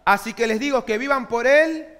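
preaching the verse out loud; loud scripture reading. Real, not acted. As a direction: proclaim the verse at full force. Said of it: "rereading verse loudly".

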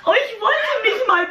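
high, laughing protest. Real, not acted. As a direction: laugh through the words. Laughing on the call.

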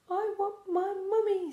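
put-on high whimpering baby owl; tiny owlet pleading for mum, high. A narrator's reading. Youngest owl Bill wanting his mother, high and whiny.

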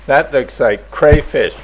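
ordinary talk

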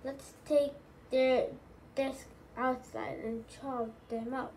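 usual speaking voice

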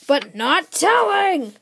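high, loud and slow villain taunt; a kid hamming up a villain's loud, slow taunt; dramatic shout. Acted. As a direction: stretch out "telling" like a booming taunt. Villain teases the hero.

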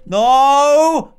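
loud, high frightened shout; panicked no. Real, not acted. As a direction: cry out in real dread. Trapped, animatronics closing in.

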